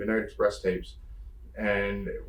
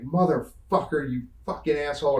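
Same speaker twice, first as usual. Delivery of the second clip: retold pissed-off cursing; 4 semitones higher than usual. Wrestler retelling his locker room fit, pissed off. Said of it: "And I was so pissed".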